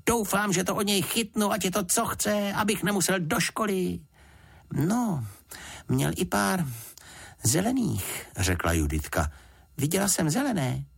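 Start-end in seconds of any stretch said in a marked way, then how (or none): 0.1-4.0 put-on high-pitched eager boy, thrilled; excited exclamation. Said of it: "zvolal Jonatán". 4.7-8.3 put-on high, childlike girl's voice; little girl. 9.8-10.9 put-on high, childlike voice; little girl.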